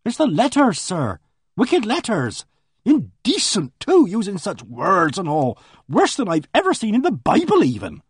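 a flustered countrywoman pouring out her news; voiced housekeeper. Narrator voices Mrs Baker.

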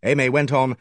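plain reading voice

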